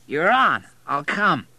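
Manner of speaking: put-on high, loud drunk agreement; tipsy, emphatic acceptance; drunken man. A narrator's reading. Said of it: "He was so drunk".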